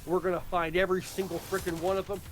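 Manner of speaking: normal talking voice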